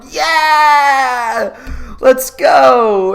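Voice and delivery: high, loud, laughing cheer; cheering through laughter; mock cheering shout. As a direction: yell it like a hyped crowd. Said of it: "And everyone's just like".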